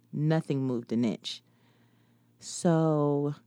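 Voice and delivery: ordinary delivery, nothing marked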